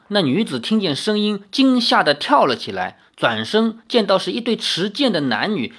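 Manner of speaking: ordinary delivery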